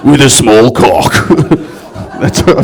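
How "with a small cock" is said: slow, low put-on voice; mock macho bloke. Comic finishing a crowd member's introduction, mock macho. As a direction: drag out the big-man confession. Continues mocked audience member's voice.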